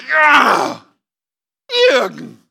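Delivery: a loud, mocking "Ja", then a flat "Jürgen"; sarcastic. Jesus mocking a churchgoer's resolution, sarcastically. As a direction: roll your eyes at Jürgen out loud. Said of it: "Jesus muss sich denken".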